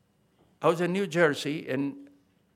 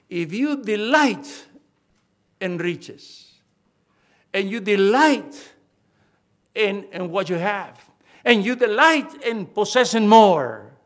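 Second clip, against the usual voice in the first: loud pulpit cadence, building to a peak; raised, forceful preacher's warning; emphatic preaching; 4 semitones higher, 9 dB louder, slower than usual. Real, not acted. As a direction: hammer each repeated phrase harder, with pauses between.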